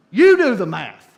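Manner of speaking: loud, pointed punchline; emphatic punchline. Real, not acted. Preacher to the congregation, loud emphatic punchline. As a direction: hit the punchline hard for a laugh.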